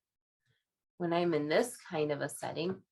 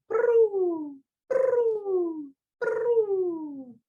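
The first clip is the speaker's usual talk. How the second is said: high rolled coos, each sliding down; pigeon cooing; 11 semitones higher, 5 dB louder, much slower than usual. A narrator's reading. Reader imitating park pigeons for children, high trilled coo. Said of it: "make a sound like a pigeon".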